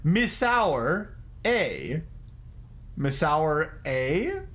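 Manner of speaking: Misour A, drawn out as "miss-OUR", then a separate rising "AY"; slow "Misour", gap, questioning "A"; deliberate mispronunciation. Shown as a demonstration. Streamer showing chat how they pronounce Missouri, deliberate mispronunciation. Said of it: "I pronounce".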